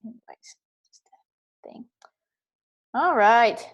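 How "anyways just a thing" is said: whispered aside. Real, not acted. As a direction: breathe it almost silently. Trails into whisper.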